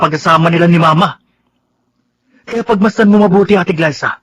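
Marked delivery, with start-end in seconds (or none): 2.4-4.2 angry confrontation. Acted. Confronting sister angrily.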